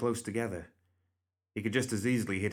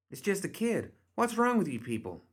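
much higher female character voice; indignant protest; 11 semitones higher than usual. A narrator's reading. Bernadette defending a child to her companions, indignant. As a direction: protest in a high, incredulous voice.

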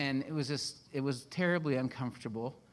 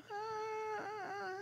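high, soft, held whine; droning "ah"; 11 dB quieter than usual. Retold or imitated. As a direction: let out a thin, drawn-out mock groan. Imitates a dull first season.

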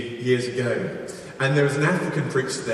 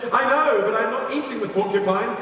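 high-pitched, hammed-up excuse; mock protesting; 7 semitones higher than usual. Hunter excusing himself to his accuser, mock protesting. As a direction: plead a technicality, pitched up.

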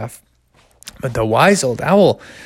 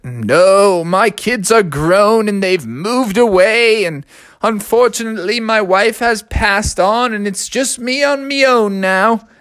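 put-on wise old owl; 7 semitones higher than usual. Old owl tells his story.

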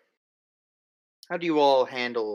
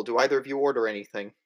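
normal talking voice

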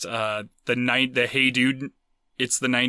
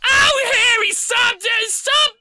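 very high, loud mock Boston screech; hammed-up Boston wife scolding at the top of the range; doorman's Boston wife; 4 dB louder than usual. Wife telling husband to stop teasing, shrieky mock Boston accent. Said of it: "his wife walks up and said".

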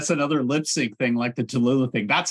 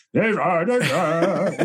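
grown-up crooner, lightly voiced; adult singer voice; 3 semitones higher, much slower than usual. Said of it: "it's like a completely different voice".

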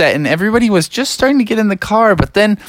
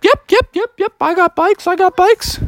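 put-on much higher child voices, quick yeps; eager kids; 13 semitones higher than usual. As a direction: chirp like excited little kids.

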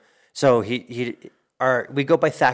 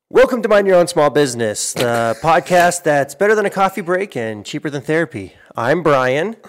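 loud, higher podcast host greeting; show intro; 6 semitones higher, 9 dB louder than usual. Acted. Host opens the small business podcast, loud show intro. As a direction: announce the show with big energy.

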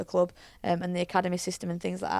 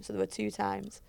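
ordinary talk, nothing marked

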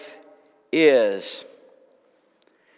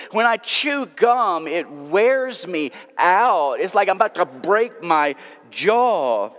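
usual speaking voice

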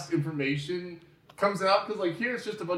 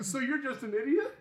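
high, giggly incredulous question; laughing mockery; 8 semitones higher than usual. Host mocking the character as an idiot, laughing incredulously. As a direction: laugh through the put-down, pitched up.

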